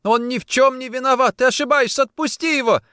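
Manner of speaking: very high, loud, overwrought protest; frantic pleading. A narrator's reading. Woman clinging to his legs pleads for prisoner, frantic. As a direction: beg wildly for his innocence.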